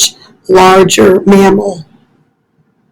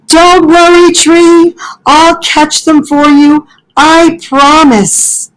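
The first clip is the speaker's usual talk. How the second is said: much higher, eager, breathless-sounding little-animal promise; eager Fletcher; 8 semitones higher than usual. Acted. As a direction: rush to reassure the tree.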